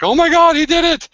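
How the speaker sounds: high, loud astonished cry; excited shout. Retold or imitated. Gambler cheering a lucky roll, high excited shout. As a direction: shriek it in disbelief. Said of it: "the gambler's like".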